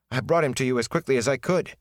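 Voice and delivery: ordinary delivery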